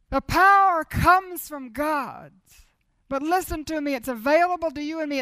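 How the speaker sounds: preaching at full force. Acted. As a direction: declare it to the congregation. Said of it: "repeated refrain, preached version".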